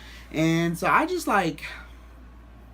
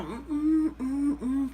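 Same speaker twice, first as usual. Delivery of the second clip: high rhythmic "mm" hums; grooving hums; 9 semitones higher, much slower than usual. Retold or imitated. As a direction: hum along as if dancing. Acts out dancing, feeling himself.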